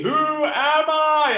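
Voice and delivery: high, loud drawn-out movie yell; Jackie Chan. Retold or imitated. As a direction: shout it slowly, as if from a rooftop. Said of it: "You know that Jackie Chan movie".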